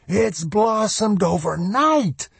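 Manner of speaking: put-on high, drawn-out elated old man; joyous cry. A narrator's reading. Sly Moody dancing round the tree, elated.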